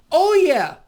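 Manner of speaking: loud, high mock-enthusiastic cry; sarcastic cheer. Real, not acted. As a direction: burst out in sarcastic approval. Sarcastic cheer at praised debt policy.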